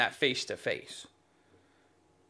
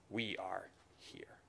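breathy whispered "we are here"; 9 semitones lower, 19 dB quieter than usual. Retold or imitated. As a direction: hush it like a secret boast. Mocking man's pride.

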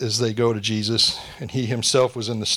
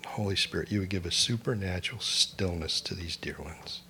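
quiet prayer; 2 semitones lower, 5 dB quieter than usual. Real, not acted. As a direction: pray gently over the congregation. Prayer for those in storms.

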